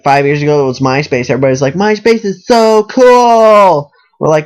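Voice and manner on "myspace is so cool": high, slow, gushing and louder; gushing fan voice. Retold or imitated. Mocking how everybody raved about MySpace, gushing. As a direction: swoon over MySpace like a giddy fan. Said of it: "We all talk like that".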